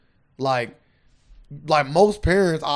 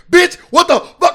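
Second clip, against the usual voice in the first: high, loud mock cuss-out; high, loud quoted cussing; mock outburst; 10 dB louder than usual. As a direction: blurt it like the offended mother. Quoting the mother's outburst.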